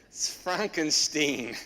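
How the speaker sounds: Frankenstein with a front-en-steen ending, slow. Shown as a demonstration.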